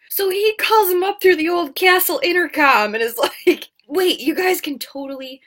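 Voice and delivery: loud amused laughing delivery. Real, not acted. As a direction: laugh through the twist. Laughing at the sudden change.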